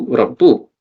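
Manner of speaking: Rabbu with a short final u, no n; light form. Shown as a demonstration. Teacher shows students the light form, Rabbu ending in short u. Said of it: "use it in a light form".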